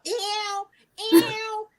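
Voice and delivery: high, squealing ew of disgust. Real, not acted. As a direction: recoil with each ew. Reacting in disgust.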